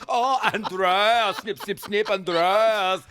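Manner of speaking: high, loud, singsong mockery; hammed-up delighted impersonation; Michael McIntyre. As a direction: croon the name long and high. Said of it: "Well, Michael's eyes lit up".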